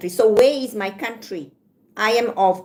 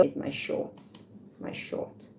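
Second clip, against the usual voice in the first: whispered aside; 5 semitones lower, 14 dB quieter, slower than usual. Real, not acted. Aside about shorts, unvoiced.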